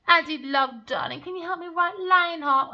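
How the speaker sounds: high, loud mock-sweet pleading; mock simpering lover. Mocking the woman begging her lover, high and loud. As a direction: ham up her sugary begging. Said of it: "She said".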